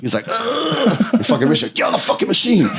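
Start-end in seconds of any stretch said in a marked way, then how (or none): 0.3-1.1 high strained "ugh"; imitated agonized groan. Imitating the man seizing up. 1.7-2.7 high-pitched mock yell; imitated yelled order. Acting Rich ordering the man.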